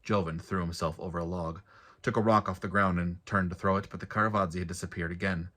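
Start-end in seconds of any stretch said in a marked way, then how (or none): none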